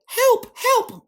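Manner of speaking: high squeaky cry for help, hammed up; mock panicked call. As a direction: pitch right up, play the frantic station. TV station calling for help.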